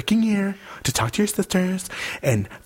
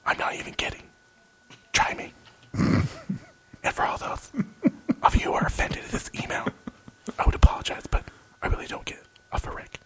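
whispering; 11 dB quieter, faster than usual. Acted. Ending the email in a hiss.

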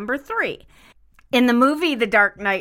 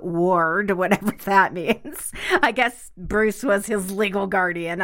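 normal talking voice

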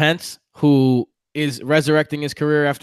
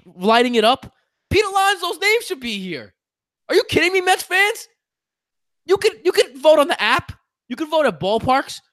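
much higher, heated outrage at the fans; emotional rant; 9 semitones higher than usual. Real, not acted. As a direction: plead with Mets fans, fired up. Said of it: "Are you done being all emotional?".

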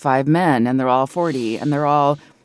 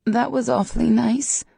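higher, slightly unsteady character voice; pitched up, a little unsteady; 9 semitones higher than usual. A narrator's reading. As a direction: manage the words with a touch of unsteadiness. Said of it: "her voice slightly unsteady".

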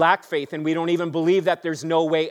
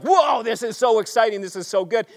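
excited whoa; 6 semitones higher than usual. Retold or imitated. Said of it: "it was just like".

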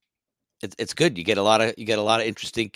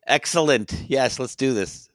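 higher, slowed, amused "let's do this"; amused eagerness; 5 semitones higher, slower than usual. Retold or imitated. Musician recalling walking up to play, amused and eager. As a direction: play it up with a laugh in the voice. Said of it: "I was kinda like".

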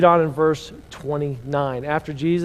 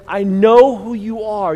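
loud, higher, drawn-out demon declaration; retold demon cry; 5 semitones higher, 8 dB louder, slower than usual. Preacher retelling what the demon said, loud retold cry. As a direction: proclaim it slowly as the demon would, voice raised. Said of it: "Did you hear what the demon said".

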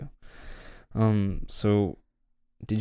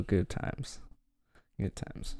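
fading whisper; 9 dB quieter, slower than usual. Real, not acted. Wistful repeat, fading.

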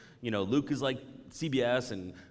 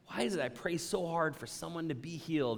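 ordinary talk, nothing marked

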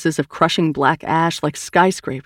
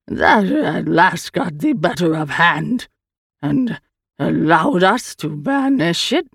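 slow, drawn-out alien voice; strange creature, recounting; slower than usual. Acted. The Eye explains Tooth to Sal, slow creature voice. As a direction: recount it slowly as an otherworldly being.

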